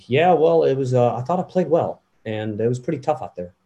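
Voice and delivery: light, understated Tiger Woods interview voice; a hint of Tiger Woods, easy and relaxed; Tiger Woods impression. Impressionist doing Tiger Woods after a round, lightly voiced. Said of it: "I heard him saying".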